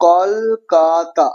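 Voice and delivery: কলকাতা slowly split as kol-ka-ta; syllable by syllable. Shown as a demonstration. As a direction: stretch the three beats of কলকাতা. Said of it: "এই যে তিনটা দল".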